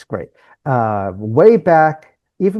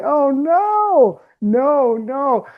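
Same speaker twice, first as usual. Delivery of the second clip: much higher, dismayed mock alarm; a playful oh no, pitched way up; 12 semitones higher, much slower than usual. Coach alarmed at student's news.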